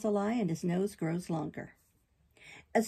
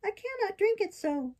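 high-pitched puppet protest; balky puppet; 12 semitones higher than usual. Acted. Puppet refusing the fairy's bitter drink, petulant. Puppet makes excuses, grimacing.

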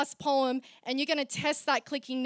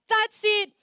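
loud, higher mock outburst; imitated fed-up declaration; 8 semitones higher, 8 dB louder than usual. Host acts out slam founder's decision, loud declaration. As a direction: burst out as the fed-up organiser. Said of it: "And he's like".

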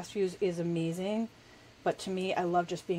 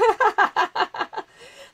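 bursting into a high, loud laugh; a real giggle at her own clumsiness; 12 semitones higher, 8 dB louder than usual. Real, not acted. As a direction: laugh it off at yourself.

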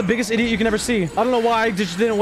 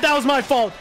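ordinary talk

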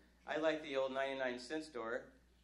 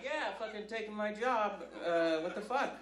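louder, slow, grumbling complaint; hammed-up angry driver; mock indignant rant; 5 semitones higher, 7 dB louder than usual. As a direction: gripe about the machines taking jobs. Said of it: "I thought he was a little team human".

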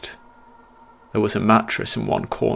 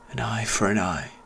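put-on ancient boy whisper; 5 dB quieter than usual. Ghost boy whispering to the fallen narrator, soft eerie whisper. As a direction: breathe it like a ghost child. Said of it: "softly whispered".

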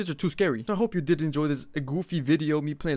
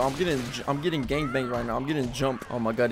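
ordinary delivery

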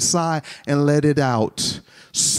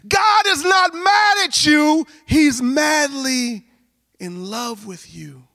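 shouted proclamation softening; 13 semitones higher, slower than usual. Real, not acted. Preacher repeating God's love a last time, shout fading to softness. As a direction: start at full cry, stretch it out, then drop tenderly.

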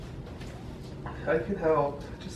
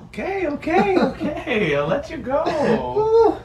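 laughing; 8 semitones higher, slower than usual. Real, not acted. Giving in while laughing.

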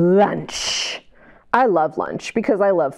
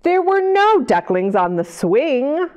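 put-on reading to children. A narrator's reading. Reads picture book aloud.

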